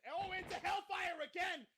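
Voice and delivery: excited commentary. Real, not acted. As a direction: shout the finisher out. Play-by-play call.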